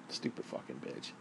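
breathy under-the-breath curse; a real whispered jab at the neighbour; whispered insult. Real, not acted. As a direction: hiss it almost silently. Muttered insult after story.

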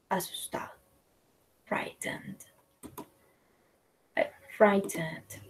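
fearful hushed whisper; scared whisper. Shown as a demonstration. Teacher acting out fear for the class, whispered. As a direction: breathe the words like you're afraid.

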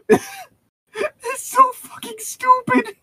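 very high, slow, wheezing through tears of laughter; can barely speak for laughing; crying laughing. Real, not acted.

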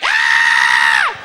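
long, very loud comic scream; losing-fight scream. Acted. Comic shows his voice when losing a fight, screaming loudly. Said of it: "when I'm losing the fight".

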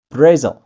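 Brazil said bruh-ZEL; loud model Brazil for repeating; English Brazil. Shown as a demonstration. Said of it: "Repeat after me".

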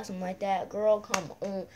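usual speaking voice

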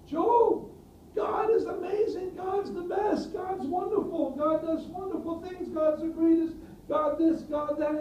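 high, gushing praise, sounding great but hollow; mock the flattering friends, pitch up; over-the-top pious flattery, high and bright; Job's friends gushing. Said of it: "Look at what his friends say".

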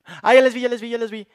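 loud, high burst of relief; excited relief. Real, not acted. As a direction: shout with sudden relief. Relief at finding lost items. (alivio emocionado)

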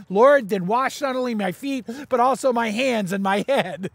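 eager plea. Retold or imitated. Scripture reading, Peter's plea.

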